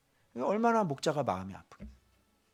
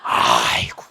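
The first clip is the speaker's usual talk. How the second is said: laughing sigh; 7 dB louder, much slower than usual. Acted. Grandfather laughs recalling.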